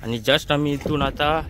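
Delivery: ordinary talk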